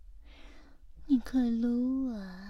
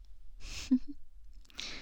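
teasing giggle; 5 semitones higher, 7 dB quieter than usual. Acted. Teasing roleplay laugh.

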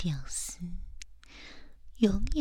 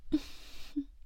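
teasing giggle; 6 semitones higher, 7 dB quieter than usual. Acted. Teasing roleplay laugh.

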